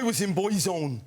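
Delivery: comic, badly done Irish brogue; bad Irish accent. Shown as a demonstration. Comic showing his second bad accent, Irish. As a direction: put on a broad, poor Irish accent. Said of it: "I can do three Irish accents badly".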